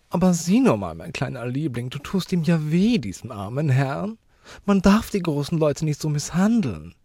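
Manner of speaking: high, affected lady's voice; meddling lady. Acted. Bystander lady reproving a child, high and affected. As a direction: scold sweetly from high up in the voice.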